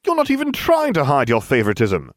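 high, loud accusation; indignant cry. A narrator's reading. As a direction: protest loudly before everyone. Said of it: "I cried".

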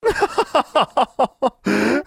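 high hissing snake laugh; sibilant liquid laugh. Acted. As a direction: let a slithery laugh rise. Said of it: "Edna's laugh was sibilant and liquid".

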